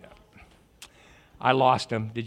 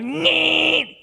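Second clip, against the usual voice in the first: mock horn; 12 semitones higher, 5 dB louder than usual. Honking sound at driver.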